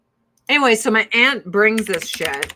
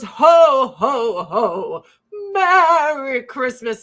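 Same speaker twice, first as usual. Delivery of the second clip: louder jolly Santa call, higher; professional Santa; 4 semitones higher, 8 dB louder, much slower than usual. Retold or imitated. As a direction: boom it like a mall Santa. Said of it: "it sounds professional".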